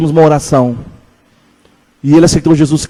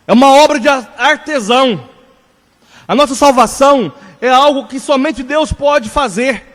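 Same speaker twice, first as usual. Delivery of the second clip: slow, higher-pitched sermon delivery, each phrase weighed; preaching; 8 semitones higher, slower than usual. Acted. Pastor teaching his congregation, in a slow, raised preaching voice. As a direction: preach it slowly, letting every phrase land. Opening sermon exposition on God's workmanship.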